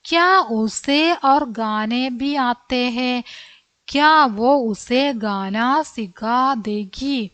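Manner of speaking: very slow, word by word for the class; slow reading. Shown as a demonstration. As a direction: spell each Hindi word out slowly for children. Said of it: "എന്താ ചോദിക്കാൻ ആഗ്രഹിച്ച കാര്യം?".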